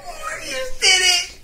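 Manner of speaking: high, slow crying shout; mocking whine. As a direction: wail it like a crybaby. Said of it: "We used to make fun of".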